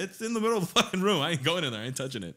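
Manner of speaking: very high, loud outburst that eases down; freaked-out refusal. Retold or imitated. Brother refusing to enter sister's room, freaked-out protest. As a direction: re-enact panicky insisting.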